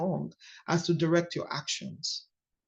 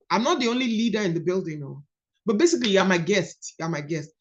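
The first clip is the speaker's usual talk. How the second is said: normal talking voice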